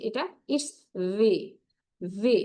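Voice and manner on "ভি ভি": "ভি" as English vee; a true English v in "ভি"; correct V pronunciation. Shown as a demonstration. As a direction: model "ভি" between bh and b. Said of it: "ভি এর উচ্চারণটা হচ্ছে".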